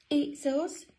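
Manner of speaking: high sing-out storybook voice; penguin sisters calling. Acted. Two sisters at Dudley's door, high call.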